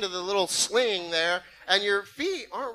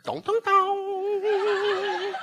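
very slow, high gong tolling; inner bell; 11 semitones higher, much slower than usual. Retold or imitated. Hearing a fight bell in his head, slow high gong. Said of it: "in my head, I heard".

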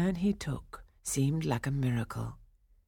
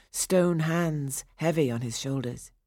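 plain reading voice

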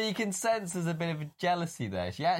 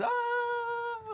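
high mock yelp of surprise; a short hammed-up cry; imitated yelp. Friend imitating Sean Connery falling, high mock yelp. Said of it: "like that".

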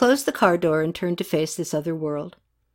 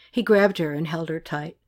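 ordinary delivery

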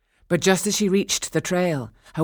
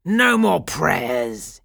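slow, forceful pirate voice; gloating pirate; 5 dB louder, slower than usual. A narrator's reading. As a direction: lay down the order word by word. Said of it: "he said, and laughed again".

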